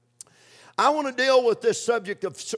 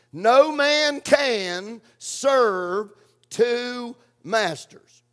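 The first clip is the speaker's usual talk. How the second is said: slow scripture stress; much slower than usual. Shown as a demonstration.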